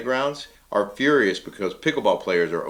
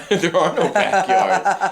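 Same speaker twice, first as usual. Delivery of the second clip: laughing; 10 semitones higher, 5 dB louder, much slower than usual. Real, not acted. Laughing at backyard question.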